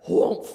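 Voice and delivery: loud crash noise, punched out; whoomph. Acted. Preacher acting out the brick hitting the car, loud whoomph. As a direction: slam the sound of the brick hitting.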